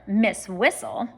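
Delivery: usual speaking voice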